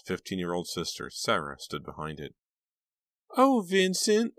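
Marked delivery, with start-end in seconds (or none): 3.4-4.3 very high, loud mock swoon; high, swooning mimicry. A narrator's reading. Sister mimicking Delia's rival, high and swooning. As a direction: mimic a lovesick girl way up high. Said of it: "Sarah mimicked in a high, swooning voice".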